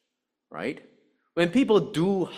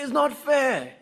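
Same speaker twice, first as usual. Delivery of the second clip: put-on much higher, louder, childish complaint; whiny protest; 10 semitones higher, 4 dB louder than usual. Preacher mimicking a listener's whiny complaint. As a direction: mimic a sulky objector. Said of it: "You say".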